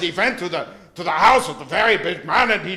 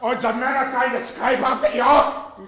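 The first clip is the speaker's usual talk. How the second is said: mock German nursery-rhyme retelling; put-on German-accented comic storyteller. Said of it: "Your version of Humpty Dumpty".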